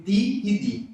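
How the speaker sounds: "ti e di" with tongue on the teeth, no palatal ch or j; dental ti and di. Shown as a demonstration. Lecturer shows students the Spanish dental sound, dental and crisp. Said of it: "ele é sempre dental".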